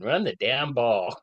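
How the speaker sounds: drawn-out mock yell at the TV; retold yell. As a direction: yell it slowly like a frustrated fan. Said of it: "as I yell to the T V screen".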